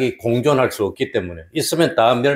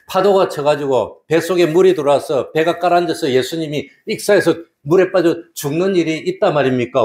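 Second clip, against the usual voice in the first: ordinary delivery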